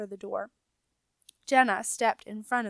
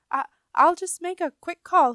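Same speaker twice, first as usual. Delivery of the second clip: nervous stammer; 8 semitones higher, 8 dB louder than usual. A narrator's reading. Rat gulped and shuffled papers.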